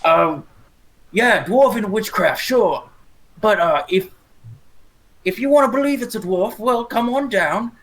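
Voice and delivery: loud in-character threat, short of a full scream. Acted. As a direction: call out a loud challenge to a foe. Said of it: "So Romulus would scream".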